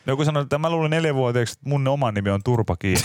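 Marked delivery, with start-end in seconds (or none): 1.5-3.0 giggling through the name; laughing. Real, not acted. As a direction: laugh while saying it. Retelling own childhood name, laughing.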